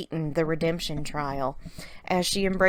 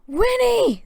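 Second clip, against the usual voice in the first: much higher, loud joyful cry; excited exclamation; 8 dB louder than usual. A narrator's reading. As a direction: call her name in delight. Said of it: "they exclaimed".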